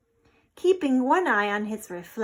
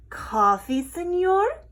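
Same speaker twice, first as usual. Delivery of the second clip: sweet offer; 9 semitones higher, 7 dB louder than usual. A narrator's reading. Martina offers coffee sweetly.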